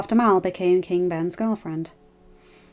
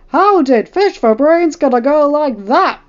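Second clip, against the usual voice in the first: lightly voiced ranting rival; ranting mimicry; 6 semitones higher, 10 dB louder than usual. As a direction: rant it out in mock outrage. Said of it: "Harry would be ranting on".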